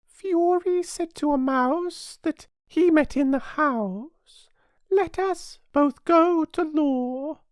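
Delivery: very high, slow character recital; mouse reciting verse. A narrator's reading. Mouse telling Alice its tale of Fury, reciting in high voice.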